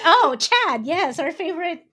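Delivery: high, loud and quick gushing; fangirl squeal. Retold or imitated. Retelling how she met their cover model, high excited gushing. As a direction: gush over him like a starstruck fan.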